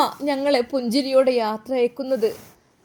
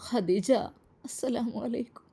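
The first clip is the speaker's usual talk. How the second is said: soft greeting that breaks, much softer on the salaam; a widower's voice cracking at the grave; voice breaking; 7 dB quieter than usual. A narrator's reading. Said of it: "ശബ്ദം തകർന്നു പോകുന്ന പോലെ". (ശബ്ദം ഇടറി പതിഞ്ഞ സ്വരത്തിൽ)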